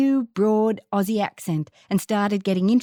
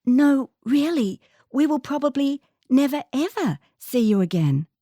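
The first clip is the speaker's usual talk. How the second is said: put-on worried little girl; 6 semitones higher than usual. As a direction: fret that they'll never meet again. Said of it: "Worry and no small amount of concern".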